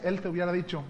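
ordinary talk, nothing marked

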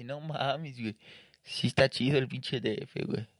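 awed newcomer. Retold or imitated. Younger self gazing up at Mexico City, hushed awe. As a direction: marvel quietly at the big city. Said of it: "yo era antes el güey que volteaba para arriba".

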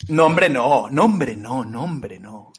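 mock despair. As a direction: despair that trails away to nothing. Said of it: "Te veo muy triste".

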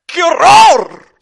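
very loud, high, drawn-out cry; horrified shout. A narrator's reading. Rasputín discovering what Stalin did, horrified shout. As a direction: bellow it slowly in melodramatic horror. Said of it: "gritó Rasputín".

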